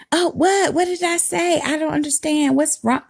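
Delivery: high, whiny mock innocence; mock innocent woman. The other woman playing innocent, mocked in a high whine. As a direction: play dumb in a squeaky put-on voice.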